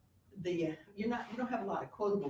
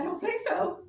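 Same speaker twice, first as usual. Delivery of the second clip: high, loud mock Hamlet; hammed-up high defiant Hamlet; Hamlet's retort; 11 dB louder than usual. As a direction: toss it off as a cocky Hamlet. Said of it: "So Hamlet said".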